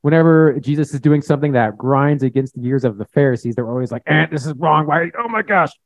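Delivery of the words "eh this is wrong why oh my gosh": high-pitched mock exasperation; hammed-up frustrated outburst; upset, offended. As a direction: sputter like an offended Pharisee. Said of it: "upset and offended and tense".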